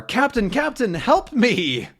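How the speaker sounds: high urgent call; Tintin calling the Captain; calling for help. Acted.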